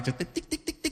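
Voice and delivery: soft, crisp tick-tick of a grill starter; quiet tongue-click ticking; igniter clicking. Retold or imitated. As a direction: imitate a barbecue igniter clicking, softly. Imitates the barbecue igniter clicking.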